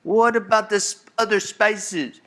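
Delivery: high, questioning son voice; a light put-on kid asking again. Son asking mama about spices, high mock voice. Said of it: "He goes".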